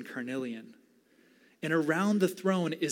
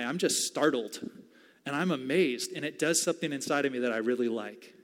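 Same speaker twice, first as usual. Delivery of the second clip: ordinary talk, nothing marked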